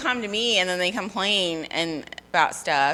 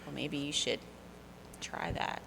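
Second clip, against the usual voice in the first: soft, halting, hushed suggestion; pause, then offer it under your voice, acted; mock-weary patience; 3 semitones lower, 12 dB quieter than usual. Acted weary advice after rejection.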